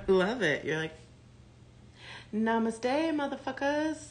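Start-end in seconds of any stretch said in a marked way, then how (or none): 2.3-4.0 sing-song high Namaste, carefree sass; breezy spiritual brush-off, pitched up; mock zen attitude. Reader voiced as serene, telling haters off.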